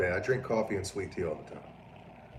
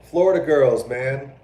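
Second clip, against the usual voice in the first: laughing retort; 5 semitones higher, 9 dB louder, slower than usual. Retold or imitated. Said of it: "I was like".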